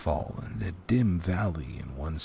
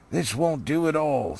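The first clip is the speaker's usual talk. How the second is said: raised, fretful dwarf voice; a worried dwarf chief, pitched up; Thorin, dwarf leader; 6 semitones higher than usual. Acted. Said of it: "said Thorn".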